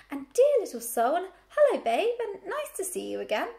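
high, loud chorus of welcome; crying out in greeting. A narrator's reading. Flock welcomes Babe back, crying out high. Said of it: "they cried".

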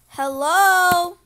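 put-on teacher yelling for the missing boy; calling out. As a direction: shout it across the bathroom. Calling into bathroom stalls.

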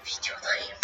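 breathy stage whisper; whispered intro. Pretend. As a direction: whisper it like a secret. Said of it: "playful intro variants".

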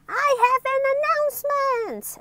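announcing. Acted. Little Miss Trouble addressing the gathered crowd, announcing. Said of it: "announced little Miss Trouble".

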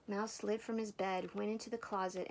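ordinary delivery, nothing marked

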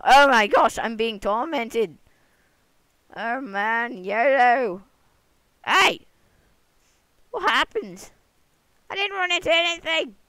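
high, loud frantic yelps; excited yelling. Real, not acted. Gamer being tormented in the game, high frantic yelling. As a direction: yelp as the game torments you.

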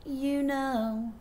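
mock mystical remark. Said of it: "I heard".

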